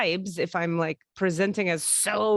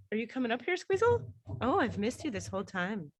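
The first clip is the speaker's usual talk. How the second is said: talking to dog; 3 semitones higher, 7 dB quieter than usual. Real, not acted. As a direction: coo up at the little dog.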